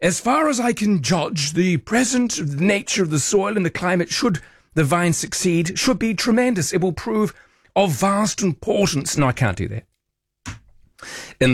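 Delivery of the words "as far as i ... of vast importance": put-on old English accent pitched high and plummy; mock posh missionary. Host reading Marsden's journal in hammed-up old English accent. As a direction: ham up an old English accent, then give up. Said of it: "He probably sounded more like".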